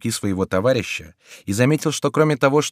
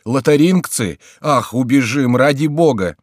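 loud, higher begging to run; panicked plea; 4 semitones higher, 6 dB louder than usual. A narrator's reading. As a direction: plead to flee in panic. Miton panics, urges flight.

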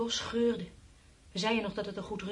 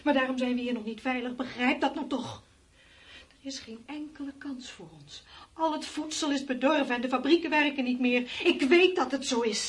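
higher and louder, pleading hard; desperate insisting; 6 semitones higher, 6 dB louder than usual. Acted. Wife insists to husband there is no chance, loud despair. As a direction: make him believe it, urgently.

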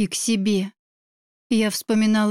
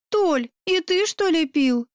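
higher, sulky little-sister voice; pouting; 6 semitones higher than usual. A narrator's reading. Yana reproaches her brother for drinking, pouting high. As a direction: pout it in a lifted voice. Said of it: "надулась Яна".

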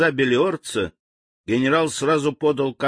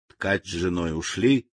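ordinary delivery

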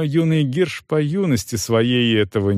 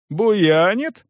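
startled question pitched up high; surprised baron; 9 semitones higher than usual. A narrator's reading. Baron hears news from the soldier, surprised. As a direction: echo it in raised-pitch surprise. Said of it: "удивился барон".

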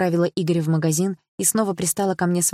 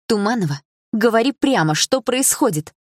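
sharp, raised angry voice; angry demand; 5 semitones higher, 4 dB louder than usual. A narrator's reading. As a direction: demand the truth angrily. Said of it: "рассерженно выдала она". (сердито, на повышенных тонах)

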